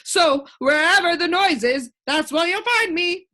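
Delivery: lightly voiced creature; the din, cheerful. A narrator's reading. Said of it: "with an appreciative smile".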